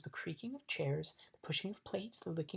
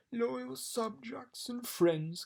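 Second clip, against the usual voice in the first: high, very slow royal address; king orating; 7 semitones higher, much slower than usual. A narrator's reading. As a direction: proclaim to a big hall, stretching words. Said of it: "his voice echoing in the almost empty room".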